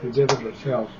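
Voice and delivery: ordinary talk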